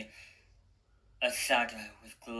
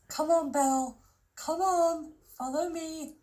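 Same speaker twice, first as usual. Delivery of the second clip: high, tender coaxing to a horse; cooing; 4 dB louder than usual. A narrator's reading. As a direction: coax the horse along gently, much higher. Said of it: "she cooed".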